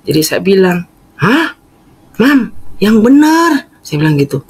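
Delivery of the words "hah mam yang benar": surprised. Retold or imitated. Speaker stunned by mother's request.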